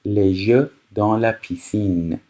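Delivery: very slow, careful French reading; slow, clear dictation. Shown as a demonstration. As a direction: read it out slowly for learners. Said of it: "teacher reads French slowly".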